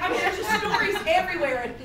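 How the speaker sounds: ordinary talk, nothing marked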